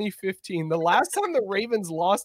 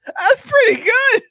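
laughing; 13 semitones higher, 8 dB louder, slower than usual. Real, not acted. Laughing praise after Mario bit.

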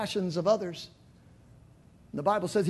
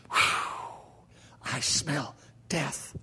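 very slow, soft whispered recoil; disgusted whisper; 6 semitones lower, 3 dB quieter, much slower than usual. Acted. Congregation recoiling from the stench, slow whisper. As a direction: breathe it out, repelled.

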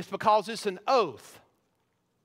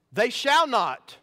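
"They shall not" slow and weighty, raised in pitch; emphatic; 4 semitones higher, 4 dB louder, slower than usual. Acted. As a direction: declare "They shall not" slowly, as a solemn oath.

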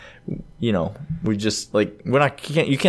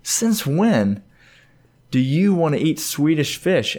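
higher-pitched mock disbelief; playfully incredulous, pitched up; retold incredulous dad; 6 semitones higher, slower than usual. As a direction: question your kid as a baffled father. Retelling his own baffled question to son.

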